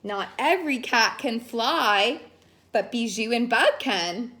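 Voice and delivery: plain reading voice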